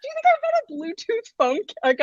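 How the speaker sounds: laughing. Real, not acted. Mocking friend's silly idea.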